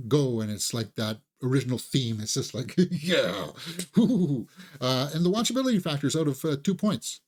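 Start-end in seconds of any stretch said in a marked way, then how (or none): 3.9-4.5 louder whooping woo-hoo; playful hooting celebration; excited cheer. Real, not acted. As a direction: cheer along with the theme song. Cheering the original theme music.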